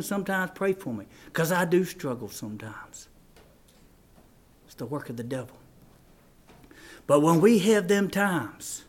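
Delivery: low, soft, solemn confession; subdued pulpit appeal with long pauses; hushed preaching. Real, not acted. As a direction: speak quietly and honestly to the congregation.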